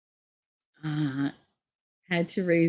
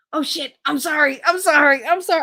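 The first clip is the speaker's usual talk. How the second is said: high, frantic mock apology; husband apologising in a high panicked voice; 10 semitones higher, 5 dB louder than usual. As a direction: squeak out the sorry as the caught husband. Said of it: "all I heard was".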